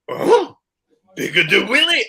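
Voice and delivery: a startled cry of surprise; shocked loadmaster. Retold or imitated. Loadmaster sees the naked skydiver, hammed-up shocked exclamation.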